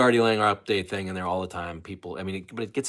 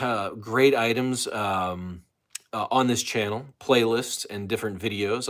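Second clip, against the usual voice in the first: ordinary talk, nothing marked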